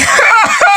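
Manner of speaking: burst of laughter. Real, not acted. Laughs at own joke.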